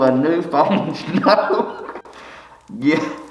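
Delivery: reading through a laugh; giggling through the line. Real, not acted. Comedian breaks into laughter reading the poem.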